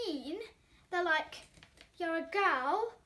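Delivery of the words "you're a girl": plain reading voice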